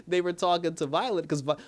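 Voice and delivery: usual speaking voice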